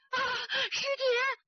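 high, tearful gasp; shocked cry. A narrator's reading. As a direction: cry out in disbelief.

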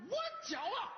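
sky-high, theatrical boast; proud declaration. Acted. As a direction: proclaim it proudly, pitch leaping right up. Father recites his proud catchphrase.

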